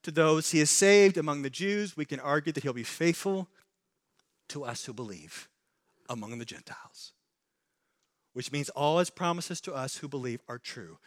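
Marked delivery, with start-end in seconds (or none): 4.5-7.1 softer, hushed promise; much softer reassurance. Real, not acted. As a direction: drop to a near hush. Tender reassurance to believers.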